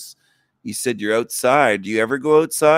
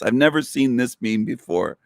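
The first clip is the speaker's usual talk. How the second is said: ordinary talk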